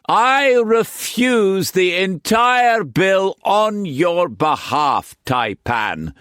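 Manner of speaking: high, slow, grandiose declaration; high, slow, pompous painter defying the madam. A narrator's reading. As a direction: puff up like a peacock. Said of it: "puffed up like a peacock".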